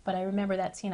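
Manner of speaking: ordinary delivery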